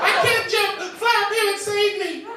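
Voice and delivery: high, loud panicked cry; desperate pleading. Acted. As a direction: beg him to fly up, shrieking. Woman trapped screaming at superhero.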